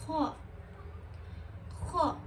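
Ho rasped hard from the back of the throat; thick throat letter. Shown as a demonstration. Teacher demonstrating a thick throat letter, rasping. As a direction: scrape Ho from the throat. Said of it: "boğazdan okunan kalın harfler".

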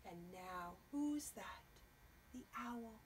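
hushed whisper for suspense; suspenseful whispered question. Acted. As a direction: whisper it to the kids.

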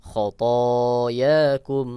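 খতায়াকুম drawn out very slowly; joined word reading. Shown as a demonstration. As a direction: stretch খতায়াকুম into one long word. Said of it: "spelled then joined". (খতায়াকুম ধীরে টেনে)

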